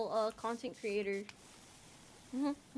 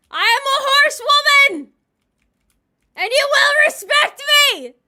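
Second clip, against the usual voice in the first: much higher, much louder roaring demand; power trip shout; 13 semitones higher, 20 dB louder than usual. Pretend. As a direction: bellow it like a tyrant. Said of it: "That was my power trip".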